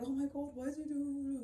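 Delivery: put-on fearful wail; mock scared. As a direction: mimic a scared person sarcastically. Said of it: "this time I should be scared".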